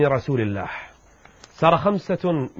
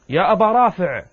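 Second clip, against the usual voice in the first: loud, higher-pitched call out; shouted call; 5 semitones higher, 5 dB louder than usual. Acted. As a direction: call out to him by name with raised voice. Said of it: "فصرخت وقلت". (نداء بصوت عالٍ)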